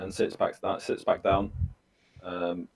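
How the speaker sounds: usual speaking voice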